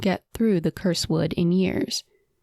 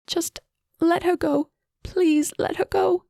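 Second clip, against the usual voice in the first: much higher, tearful begging; crying plea; 11 semitones higher than usual. A narrator's reading. Sobbing sister begging Amira to let the queen go, crying. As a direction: plead through tears. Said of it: "Fonra was crying".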